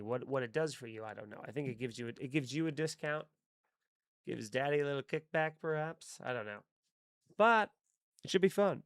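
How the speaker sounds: ordinary talk, nothing marked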